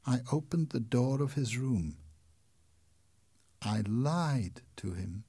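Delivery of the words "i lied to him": plain reading voice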